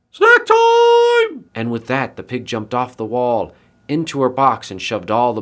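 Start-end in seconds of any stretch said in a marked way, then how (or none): 0.1-1.5 very high, loud squeal; gleeful pig shout. A narrator's reading. Pig pouncing on the woman's basket, squealed war cry. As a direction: shriek it out, stretching the last word.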